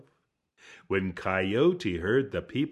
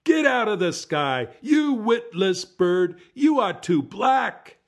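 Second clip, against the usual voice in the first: loud, much higher furious yelling; angry crowd shouting; 13 semitones higher, 8 dB louder than usual. A narrator's reading. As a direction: chase the bird out of the sky. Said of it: "The people groaned".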